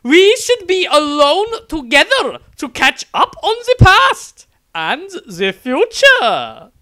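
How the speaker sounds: an adoring nun cooing at the wizard she loves; gushing besotted nun. Acted. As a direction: swoon over him like a lovesick nun. Nun suggests being alone.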